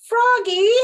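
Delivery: high, loud long call. Acted. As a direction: call him from far away. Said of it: "called his mom".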